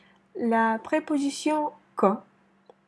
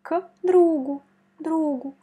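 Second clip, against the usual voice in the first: другу higher and louder, accent on the у of the first syllable; stress on другу; 5 semitones higher, 3 dB louder than usual. Shown as a demonstration. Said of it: "On met l'accent sur ou". (accent sur le у de другу)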